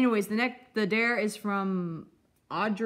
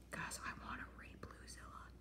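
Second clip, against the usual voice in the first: whispered aside; 23 dB quieter than usual. Acted.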